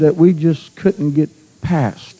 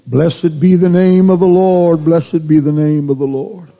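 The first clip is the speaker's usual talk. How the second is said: leading worship prayer. Acted. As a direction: lift the room into thanksgiving. Leads the congregation in prayer.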